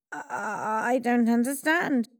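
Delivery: the caught villain stammers in fear; trembling Haman. A narrator's reading. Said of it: "Haman trembled".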